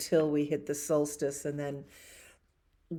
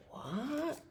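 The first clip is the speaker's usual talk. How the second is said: surprised pigeon; 10 semitones higher, 7 dB quieter than usual. Acted.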